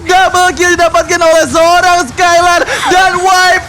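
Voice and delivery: high, slow hype shout; fighting game announcer. Acted. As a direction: bellow the wipe like an arena announcer. Caster shouts the team wipe.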